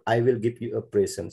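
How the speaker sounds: "present" as PREH-sen; noun pronunciation. Shown as a demonstration. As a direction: say "present" with an open e first. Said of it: "present refers to noun or adjective".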